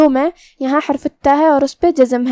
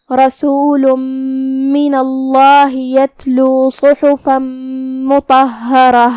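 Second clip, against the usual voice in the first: Quran recitation; much slower than usual. Acted. Teacher recites the verse to students, slow melodic chant. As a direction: chant the verse slowly as in tajweed.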